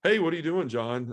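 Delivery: retold cheerful greeting. Sister greets John, unaware of the news, imitated cheerful greeting. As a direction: open with a lifted, casual hey.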